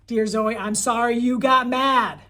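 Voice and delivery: loud, drawn-out, hammed-up apology; mock angry apology. Boy forced to write an apology to Zoe, mock angry. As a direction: read the grudging note slowly at a mock yell. Boy's insincere apology note read aloud.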